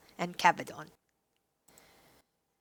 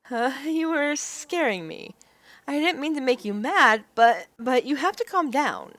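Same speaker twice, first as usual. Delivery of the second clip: raised heroine voice, frightened; 4 semitones higher than usual. Acted. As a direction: beg him to stop. Heroine scared.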